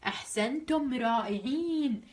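usual speaking voice

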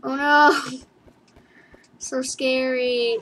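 usual speaking voice

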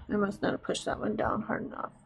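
whispered to herself; half-whispered aside. Real, not acted. Mutters to herself about the stamp.

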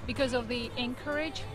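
ordinary delivery

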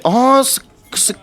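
excited urging. Real, not acted. Urging teammates to press.

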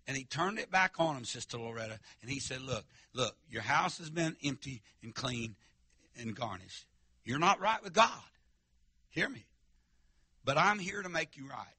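normal talking voice

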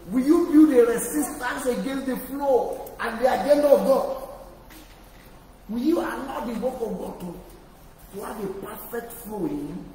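loud, high, soaring sermon climax; full-force preaching. Acted. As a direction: shout God's agenda to the whole church.

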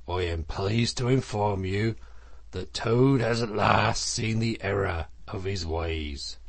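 measured, weighty announcement in Badger's voice; solemn Badger. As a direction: formally report a penitent's promise.

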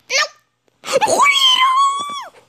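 long high drawn-out cry; anguished shout. Real, not acted. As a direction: wail at the goalkeeper. Goalkeeper lets one in.

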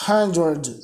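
Hundred said slowly and clearly, stressed on the first syllable "HUN-dred"; pronunciation model. Shown as a demonstration. Teacher showing students how to say Hundred, careful model. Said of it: "Ó, olha a pronúncia".

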